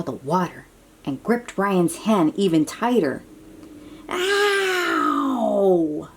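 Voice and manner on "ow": short sharp ow of pain; cry of pain. Acted. Ryan yelping as the dragon grips his hand. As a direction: yelp as the hand gets squeezed. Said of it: "Ryan is shouting in pain".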